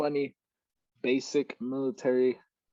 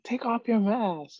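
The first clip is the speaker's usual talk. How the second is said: high, slow mock command; instructor's order; 10 semitones higher, slower than usual. Instructors to trainees in gas chamber, mock high order. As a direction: call it out like a drill instructor, pitched up.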